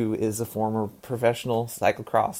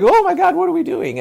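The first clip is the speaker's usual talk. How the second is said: much higher, louder panicked squeal; mock panic; 6 dB louder than usual. The cycling industry reacting in alarm, a high panicked squeal. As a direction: squeal it like everyone's lost their heads.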